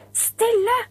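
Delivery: sharp, high, commanding shout of the teacher. Acted. Teacher shouting over the noisy class, commanding shout. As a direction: silence the whole class at once. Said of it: "Men frøken ropte høyere".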